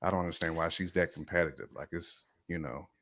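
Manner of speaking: ordinary talk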